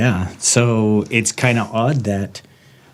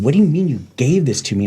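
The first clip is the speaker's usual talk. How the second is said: retold indignant challenge, pitched up and quick; angry retort; 6 semitones higher, faster than usual. Man arguing with God over his suffering, angry. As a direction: throw it back in angry disbelief. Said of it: "I was angry at God".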